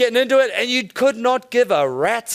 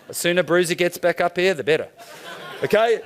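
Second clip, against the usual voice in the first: ordinary delivery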